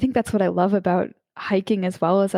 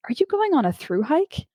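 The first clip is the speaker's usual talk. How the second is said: light high-pitched stranger's question; a hint of a curious stranger stopping a hiker; stranger asking; 8 semitones higher than usual. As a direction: ask it like a curious passer-by on the sidewalk. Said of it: "And she says".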